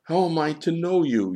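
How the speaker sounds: high, loud helpless appeal; urgent, high-pitched plea; pleading. A narrator's reading. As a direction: plead as if truly at a loss. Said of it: "Johann said, pleading".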